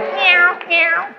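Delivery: tiny high-pitched mewing; timid kitten meow. Acted. Church that should be a lion, high feeble meowing. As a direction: mew weakly like a scared kitten. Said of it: "the church is going".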